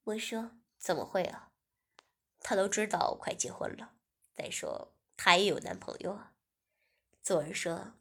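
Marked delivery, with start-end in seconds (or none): 0.8-6.3 lower, steady man's voice for 文轩; male lead's voice. Acted. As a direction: speak as the calm young boss. Narrator voices male lead. (低沉的男主角声音)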